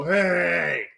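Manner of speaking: mock stranger's call; imitated alley holler.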